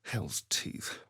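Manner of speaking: a low, voiceless oath under the breath; muttered curse. Acted. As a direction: swear to yourself, barely audible. Cursing under his breath.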